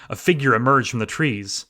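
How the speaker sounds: ordinary delivery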